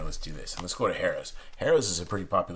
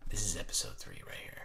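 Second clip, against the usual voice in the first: hushed whisper; 3 semitones lower, 8 dB quieter than usual. Real, not acted. Vlogger whispering to viewers over the exciting card deck. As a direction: whisper "This is episode three right here" softly. Repeats line, then whispers it.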